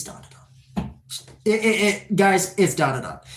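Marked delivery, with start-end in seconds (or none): 0.0-0.9 hushed, slow whisper. Acted. Streamer whispering his favorite anime to chat. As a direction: breathe the name like a secret.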